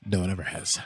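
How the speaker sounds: normal talking voice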